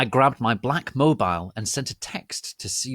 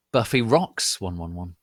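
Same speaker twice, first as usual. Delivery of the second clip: usual speaking voice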